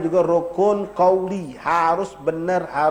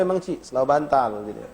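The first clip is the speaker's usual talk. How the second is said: normal talking voice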